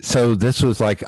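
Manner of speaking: ordinary talk, nothing marked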